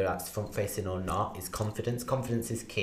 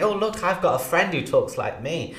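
much higher, louder chirpy quote; bubbly mock customer, pitched up and loud; high and upbeat; 8 semitones higher, 6 dB louder than usual. Shown as a demonstration. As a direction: gush it like a delighted caller. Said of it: "you talk really high and upbeat and say".

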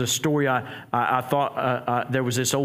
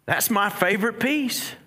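mock-innocent, higher-pitched punchline; innocent husband; 7 semitones higher than usual.

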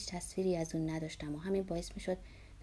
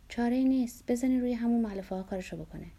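raised, high-pitched brisk order; louder, higher nurse's command; impatient order; 5 semitones higher, 6 dB louder than usual. A narrator's reading. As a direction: call it out sharply to the staff. Nurse snaps orders in story.

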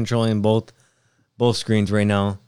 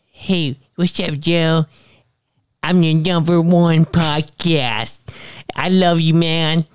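high, slow, lisping boxer voice; Mike Tyson impression; 6 semitones higher, 4 dB louder, slower than usual. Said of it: "why are you talking like Mike".

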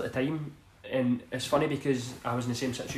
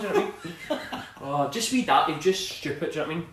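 ordinary delivery

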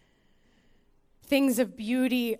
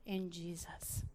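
whispered; 4 semitones lower, 15 dB quieter, slower than usual. Acted. Drops to whisper.

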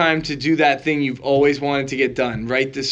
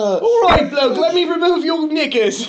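over-the-top British impression, pitched way up; Bert Kreischer; 12 semitones higher than usual. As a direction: ham up a silly British bloke. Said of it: "so he did a British one".

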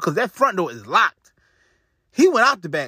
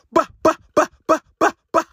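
sharp, high mock gunshots bah bah bah; gunshot sounds; 9 semitones higher, 4 dB louder, faster than usual. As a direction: fire off six imitated shots. Imitating six gunshots.

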